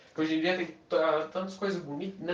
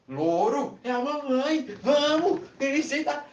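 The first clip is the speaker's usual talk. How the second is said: high coaxing call; coaxing mother voice; 10 semitones higher, 3 dB louder, slower than usual. Acted. Woman coaxes her silent parrot to talk. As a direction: urge the parrot on, higher.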